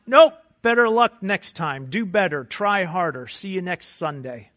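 a hint of a curt priest brushing you off; mock priest waving you away; dismissive priest.

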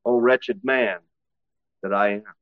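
low, soft, defeated sigh of a cry; a hint of a broken man giving up; weak lament. Preacher voicing Paul as a weak lament.

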